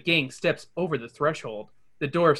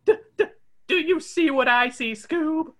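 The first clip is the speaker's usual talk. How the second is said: much higher, stammering Shaggy; stuttering Shaggy; 11 semitones higher than usual. Acted. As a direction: gulp in disbelief. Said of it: "Shaggy gulps in disbelief".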